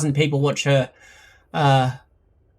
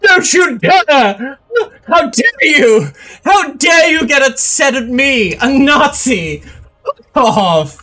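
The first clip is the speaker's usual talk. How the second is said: shaking with anger; 7 semitones higher, 6 dB louder than usual. Retold or imitated. Mock outrage at a Nazi.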